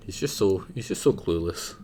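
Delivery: ordinary talk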